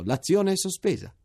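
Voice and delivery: high, loud joyful announcement; shouting. A narrator's reading. Major shouting the news to the narrator, high and loud. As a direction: call it out with relief. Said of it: "gridò".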